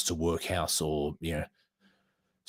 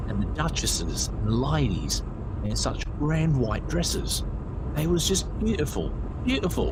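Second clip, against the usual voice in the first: rising much higher in wonder on beautiful; marvelling; 8 semitones higher than usual. Acted. Woman marvels at duchesses' dresses.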